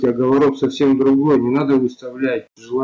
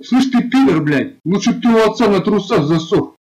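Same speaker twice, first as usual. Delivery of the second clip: furious insults; 5 semitones higher than usual. Real, not acted. Player hurling insults at the old man, furious. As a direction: spit the insults out high.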